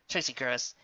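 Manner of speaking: plain reading voice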